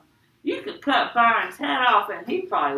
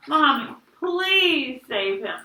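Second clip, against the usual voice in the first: raised-pitch, slow, pleading whine; blubbering plea; 5 semitones higher, 4 dB louder, slower than usual. A narrator's reading. Joey begs Mommy to save brother, tearful. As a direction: plead tearfully, stretching "please". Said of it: "Joey blubbered out".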